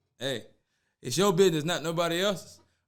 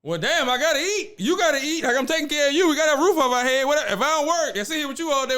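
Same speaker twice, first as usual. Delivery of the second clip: loud, higher, exasperated mock rant; indignant boyfriend rant; 8 semitones higher, 4 dB louder than usual. Boyfriend answering his girlfriend's excuse, loud exasperated rant. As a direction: fire back at her excuse, louder and louder. Boyfriend rants back at cheater.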